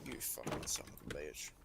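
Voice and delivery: low whispered curse; whispered insult. Real, not acted. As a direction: hiss it under breath. Mock insult after roast.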